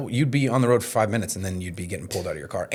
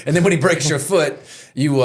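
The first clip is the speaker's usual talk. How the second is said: normal talking voice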